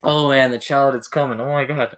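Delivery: normal talking voice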